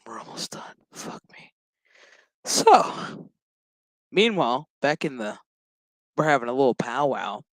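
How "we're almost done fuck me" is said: very soft, whispered aside; weary whisper. Real, not acted. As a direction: whisper it to yourself, wearily. Weary muttered aside to self.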